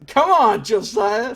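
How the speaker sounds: loud, high-pitched self-scolding; exasperated shout at own mistake; exasperated outburst. Real, not acted. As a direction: burst out in frustration at yourself. Scolds self after botched word.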